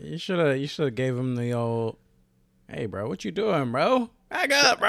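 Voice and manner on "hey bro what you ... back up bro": rising to a high, loud challenge; mock confrontation. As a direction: build from casual to shouting him off. Acts out confronting the line crowder.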